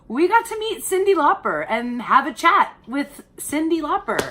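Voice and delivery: gushing with delight about meeting a star; excited gush. Real, not acted. Thrilled host telling viewers about meeting [name], excited gush.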